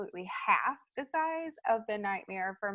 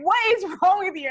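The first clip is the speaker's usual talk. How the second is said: neighbor's alarmed question; 11 semitones higher, 12 dB louder, faster than usual. Retold or imitated. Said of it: "she's like".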